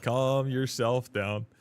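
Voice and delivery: drawn out, slow and deliberate; slow calm-down. Retold or imitated. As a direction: slowly spell out calm yourself down to a brat. Telling the chatter off.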